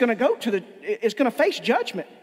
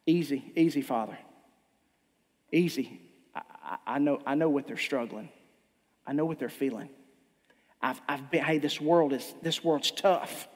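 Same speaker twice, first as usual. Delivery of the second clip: Jesus pleading softly; 9 semitones lower, 5 dB quieter than usual. Acted.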